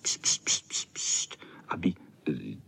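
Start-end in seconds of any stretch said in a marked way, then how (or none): 0.0-1.4 whispered pst pst; shushing. A narrator's reading. Boys hush each other at the gnome, whispered shushing. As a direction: hiss a quick hushing pst. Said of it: "dělali pst".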